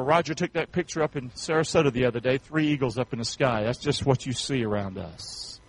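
ordinary talk, nothing marked

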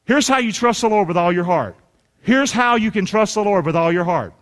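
ordinary talk, nothing marked

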